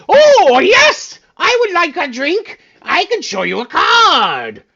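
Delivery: very high, loud excited shriek; excited squeal. Acted. Gnome squealing for a free drink.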